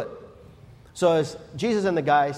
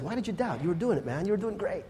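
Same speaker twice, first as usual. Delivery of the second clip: soft consoling; 3 semitones higher, 7 dB quieter, faster than usual. Acted. Jesus gently consoling Peter.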